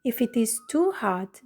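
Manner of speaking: ordinary talk, nothing marked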